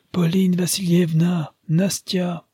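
high-pitched call for the household. A narrator's reading. A gentleman summoning his family as a visitor arrives, calling out. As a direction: call out names, high but not shouted.

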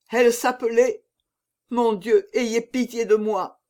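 usual speaking voice

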